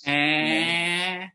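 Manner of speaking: drawn-out, sustained "Eh" showing the Valley vowel; long vowel. Shown as a demonstration. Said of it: "all the long vowels".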